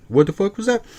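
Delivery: mock-shocked mother; incredulous mom. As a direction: call out the nonsense. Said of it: "the mom just like".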